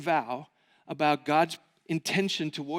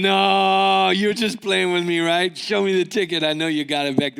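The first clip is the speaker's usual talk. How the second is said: louder, insistent teasing denial; loud teasing insistence; loud disbelief; 6 dB louder than usual. Retold or imitated. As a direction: push him, sure he's joking. Buddy insisting it's a joke.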